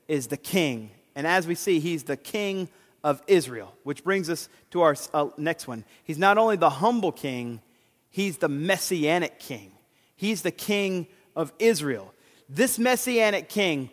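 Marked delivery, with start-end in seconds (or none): none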